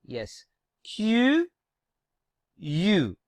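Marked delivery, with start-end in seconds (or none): none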